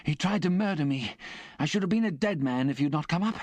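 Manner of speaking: a frightened convict's high, urgent complaint; horrified. A narrator's reading. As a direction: repeat the charge in rising horror. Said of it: "in extreme horror of his companion".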